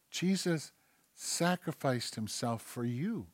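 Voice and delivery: soft, slow and tender-weighted; gentle reminder. Acted. Answering the complainer about Jesus' sacrifice, hushed and gentle. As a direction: let it sink in quietly.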